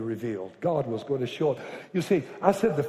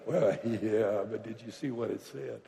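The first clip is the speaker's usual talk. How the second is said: doubtful devil voice; 6 semitones lower, 6 dB quieter than usual. Retold or imitated. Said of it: "the devil wants you to qualify".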